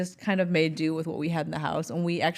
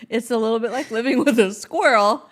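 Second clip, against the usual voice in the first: laughing; 5 semitones higher, 7 dB louder than usual. Real, not acted. Teasing roommate, laughing.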